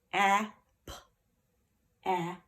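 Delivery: "ap" as short a, then a popped p; "ap" said loudly in separate sounds; sounding out syllable. Shown as a demonstration. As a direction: sound out "ap" one sound at a time. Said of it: "First syllable".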